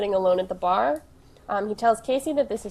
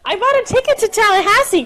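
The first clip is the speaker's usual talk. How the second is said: high, loud excited confession; excited blurt; 10 semitones higher, 7 dB louder than usual. Real, not acted. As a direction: burst out with the news.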